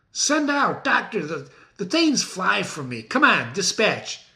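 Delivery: teacher acting out Macbeth calling for armor; Macbeth in a rage. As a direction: bark orders at servants, frantic. Teacher reads Macbeth's lines dramatically.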